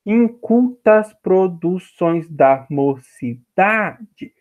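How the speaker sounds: very slow, syllable-by-syllable scansion; counting poetic syllables. Shown as a demonstration. Teacher showing students how to scan a poem, slow syllable-by-syllable delivery. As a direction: speak the verse as if counting each syllable. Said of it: "falando o poema e contando".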